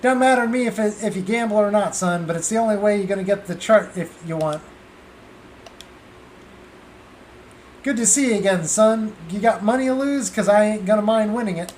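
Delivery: a folksy southern card sharp sizing up a mark; easygoing southern hustler; southern gambler. Acted. The gambler Brett greets the kids.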